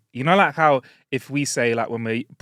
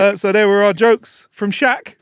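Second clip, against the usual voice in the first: slow, louder hyped sign-off; hyped outro; 7 semitones higher, 6 dB louder, slower than usual. Acted. Host hyping up the Shaq jokes, slow and louder. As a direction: wrap it up like a showman.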